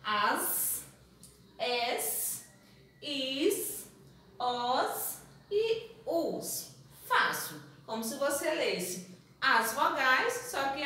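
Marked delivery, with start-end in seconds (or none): 0.0-6.8 each syllable spoken slowly apart; slow syllable drill. Shown as a demonstration. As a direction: read the syllables out one by one, slowly. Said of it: "Vamos lá?". (sílabas devagar, uma por uma)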